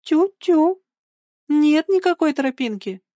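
high, sing-song cuckoo taunt. A narrator's reading. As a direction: tease him in a high, lively voice. Girl taunts boy with cuckoo gesture.

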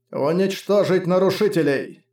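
slow, rasping machine voice; robotic servitor through a voice box; servitor vox voice. A narrator's reading. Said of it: "Существо прохрипело через какой-то имплантированный вокс-кодер".

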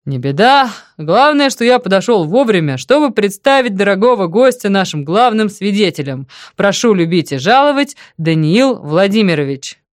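громко и бодро, выше обычного; представь гостя шумно и весело; развесёлый. A narrator's reading. (развесёлый громкий голос)

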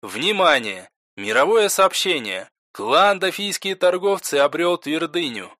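game system's announcer voice; system announcement. Acted. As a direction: announce it as a game's system message. Game system announces a world message.

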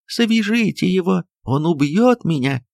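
high terrified call, slowing at the end; panicked plea. Acted. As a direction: beg them to tie him up. Жена в ужасе зовёт вязать. (в ужасе, высоко)

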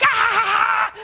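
high, loud playful yell; shrieking like children in a snowball fight; childlike scream. Retold or imitated. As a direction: scream like a kid in the snow. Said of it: "screaming like children".

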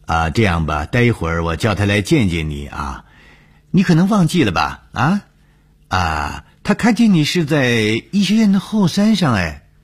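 doctor putting on a show, pitch leaping high; feigned sincerity. Acted. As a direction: make a tall tale sound true, swooping up high. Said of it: "装出确有其事的样子".

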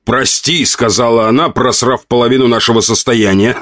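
high-pitched mimicry breaking into laughter; scornful, laughing husband; laughing mockery. A narrator's reading. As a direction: mockingly echo her apology, then laugh. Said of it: "Рассмеялся мужчина".

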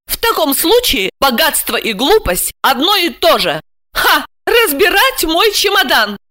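high-pitched, haughty outrage; seething anger. A narrator's reading. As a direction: boil over with indignation. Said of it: "Александра кипела от гнева". (кипя от гнева)

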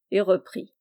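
usual speaking voice

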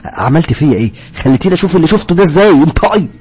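furious accusation. Acted. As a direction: scream at her, calling her a liar. Accuses Sara, shouting.

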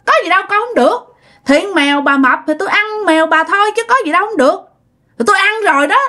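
high-pitched, brazen self-justifying; defiant arguing back. Acted. Neighbor refuses to pay grandma for the cat, defiant. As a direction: argue back stubbornly, refusing all blame. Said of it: "cha này gân cổ lên nói".

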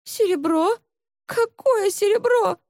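high-pitched bewildered question; frightened servant woman. A narrator's reading. Kneeling maid answers the fierce accuser, frightened and high.

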